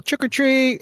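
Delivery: high-pitched singsong call without extra volume; playful high holler at a door; pretend yell. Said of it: "I yell".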